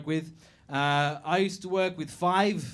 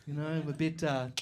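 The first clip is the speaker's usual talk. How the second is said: softer, lower airy psychic voice; coy and self-important, lightly hammed-up; a stranger claiming a bit of psychic gift, softly; mock mystic; 4 semitones lower, 5 dB quieter than usual. Imitating a would-be psychic.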